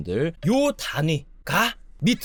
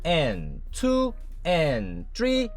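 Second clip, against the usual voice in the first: counting each beat and "and" very slowly; slow eighth-note count; much slower than usual. Shown as a demonstration. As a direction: spell out the subdivisions, very slow. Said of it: "팔 분음표 단위로 읽어볼 수 있는 거예요". (아주 느리게 박을 세며)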